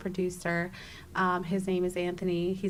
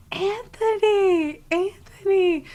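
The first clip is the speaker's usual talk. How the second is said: long, high, loud drawn-out call; screaming a name; 12 semitones higher, 9 dB louder, slower than usual. Retold or imitated. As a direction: stretch the name out as a loud cry. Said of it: "And I start screaming".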